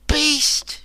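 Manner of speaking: very high furious cry; cried out. Acted. Woman cursing the warrior who chained her, high fury. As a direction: fling the insult at him. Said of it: "she cried".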